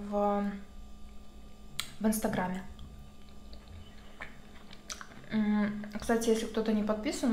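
chewing while talking; muffled by chewing food; mouth full of salad. Real, not acted. Seen 0: Ate salad just before; jaw and lips chewing through about 1.9 s.

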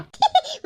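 high, delighted cackle; high gleeful laugh. Acted. Mother-in-law cackling with daughter-in-law over the prank, high. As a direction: laugh along with the plan.

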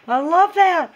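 high, loud squeal of delight; gushing delight. Real, not acted. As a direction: squeal it with glee. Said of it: "Get a grip, [name]".

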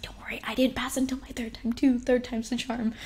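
whispered, gentle mom consoling; comforting mom. Retold or imitated. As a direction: hush it kindly like a mother soothing. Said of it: "my mom was like".

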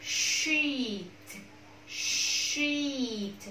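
"Sheet" with a drawn-out ee; "Sheet" said as a model, ee stretched; long ee vowel. Shown as a demonstration. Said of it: "Âm y dài".

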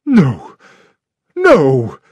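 a lightly voiced wild outburst; wildly. A narrator's reading. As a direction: blurt it out in a panic. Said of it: "said Miss Howard wildly".

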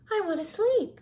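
high, complaining mouse; high-pitched little complaint; pleading sleepy mouse. A narrator's reading.

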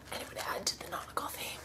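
quick whispered aside; a rushed little whisper. Real, not acted. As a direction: whisper it fast to yourself. Aside while planning outfit.

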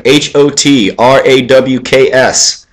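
slow letter-by-letter spelling; spelled out slowly. Shown as a demonstration. Telling viewers the website, slow spelling. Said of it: "Go there".

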